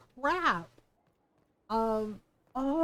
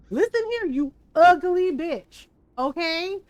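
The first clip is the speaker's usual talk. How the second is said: mock threat; 3 semitones higher, 7 dB louder than usual. Repeating threat to Bianca.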